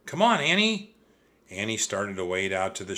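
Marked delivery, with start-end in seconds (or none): 0.0-1.0 high, loud call across the water. A narrator's reading. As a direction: call her over, louder and higher. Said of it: "he called".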